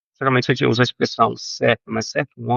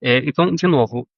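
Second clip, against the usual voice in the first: ordinary talk, nothing marked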